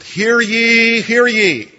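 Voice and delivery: loud, high town crier call; herald's cry. Retold or imitated. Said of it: "in terms of Old England".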